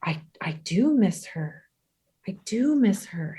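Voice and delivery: softly whispered, choked admission; tearful whisper. Retold or imitated. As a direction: breathe it out on the edge of tears. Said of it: "and then I started crying".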